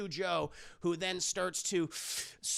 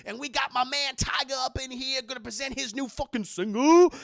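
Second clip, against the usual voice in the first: put-on high, loud frat-boy announcer; party host hype; 6 semitones higher, 6 dB louder than usual. [name] introduces [name] to the party, hyped up. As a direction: hype the crowd, stretching the line endings.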